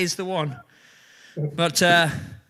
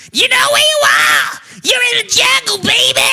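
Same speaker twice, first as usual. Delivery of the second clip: screeching rock-singer yell, much higher and louder; hammed-up high rock screech; 9 dB louder than usual. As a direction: scream it like a hair-metal frontman. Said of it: "my [name] impression".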